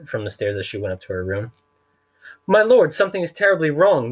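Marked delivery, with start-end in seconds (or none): 2.5-4.1 high, loud alarmed exclamation; a fussy man startled by a noise; high-pitched worried gasp of a flustered man; alarmed banker. A narrator's reading. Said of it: "Mister Poe said to himself".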